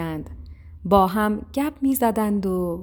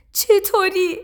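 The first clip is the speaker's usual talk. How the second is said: high, loud protest from a little sparrow; doubtful cry; 11 semitones higher, 6 dB louder than usual. A narrator's reading. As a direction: cry out in disbelief, high-pitched. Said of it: "با تردید فریاد زد". (فریاد زیر و پرتردیدِ گنجشک)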